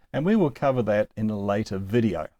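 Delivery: ordinary talk, nothing marked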